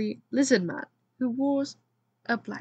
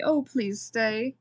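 pleading; much slower than usual. Acted. Bernard pleading her to stay.

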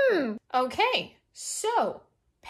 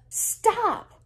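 higher, cutting in angrily; angry; 10 semitones higher than usual. A narrator's reading. Ella cutting off Kenji's insults, angry. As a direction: interrupt with an angry exclamation. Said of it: "Ella says angrily".

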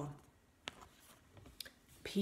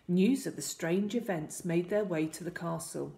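usual speaking voice

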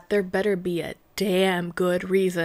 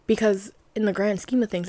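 ordinary talk, nothing marked

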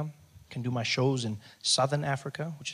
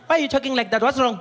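high, loud interrogation; caricatured vengeful clerk; taunting receptionist; 11 semitones higher, 7 dB louder than usual. Acted. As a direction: grill the patient mockingly. Receptionist mocking the patient's voice.

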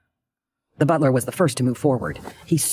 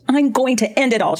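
much higher, hysterical wail of a threat; melodramatic cry; 12 semitones higher than usual. A narrator's reading. Daughter fleeing upstairs from her mother, melodramatic cry. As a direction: cry it out like a dramatic teenager. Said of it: "she cried".

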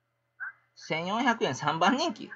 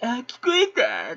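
higher and drawn-out fake discovery; hammed-up mock streamer; mock fake surprise; 7 semitones higher, slower than usual. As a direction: act out a staged little surprise. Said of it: "とか言うような".

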